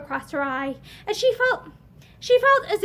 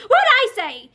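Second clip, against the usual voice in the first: higher, louder angry shout; 8 semitones higher, 7 dB louder, slower than usual. A narrator's reading. Drunk husband shouting his answer at frightened wife. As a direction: snap it back at her.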